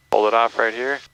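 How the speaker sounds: usual speaking voice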